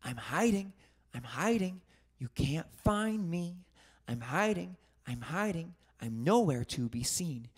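quiet hiding song; soft singsong. Acted. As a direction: sing it softly, hiding. Hide and seek song.

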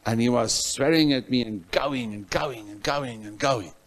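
ordinary delivery

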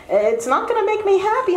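a mother begging him to stay; mother pleading. Retold or imitated. Mother begging her son not to go. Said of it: "You go".

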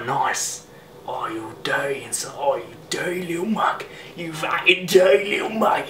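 leering, half-whispered Cockney; Cockney geezer dropping to a rough whisper, then rising; attempted Cockney. Man ogling someone's mug, attempted Cockney accent. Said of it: "Then there's my attempt at Cockney".

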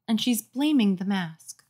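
usual speaking voice

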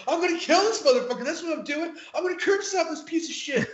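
wild mock-enraged outburst; retold raging threat. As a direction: rant like you've lost your mind, half laughing by the end. Retelling own rage in fight.

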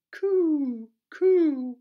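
very high, loud cooing; high dove coo. Shown as a demonstration. Teacher cooing for children to copy, very high and loud. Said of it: "make some bird sounds with me".

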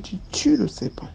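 hushed whispered command; whispered order. Retold or imitated. Nanny urging the girl to kill the snake, whispered. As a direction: hiss the order under the breath. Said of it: "elle me dit".

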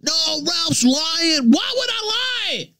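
high, hammed-up fake denial; mock protest. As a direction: parody a liar protesting. Mocking the other side's claims.

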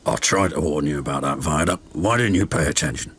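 put-on low, quick grumbling old fogman; cross Old Bailey. A narrator's reading. Fogman scolding crew who ignored his warning, cross. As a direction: scold them in a low, rapid voice. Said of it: "Old Bailey was very cross".

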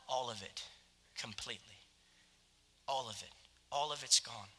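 tender quiet assurance; hushed. Acted. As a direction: let it drop to a near hush. Quiet answer after question.

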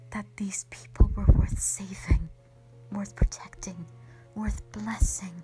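loud, forceful insistence; impassioned plea. Acted. Dragon insisting humanity deserved saving, impassioned plea.

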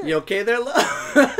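put-on high laughing New Zealand accent; a giggly New Zealand accent. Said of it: "my New Zealand accent was perfect".

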